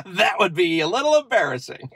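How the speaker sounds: real chuckle around the words; amused laughing. Real, not acted. Joking to viewers about an unmuted-call slip, amused laughing.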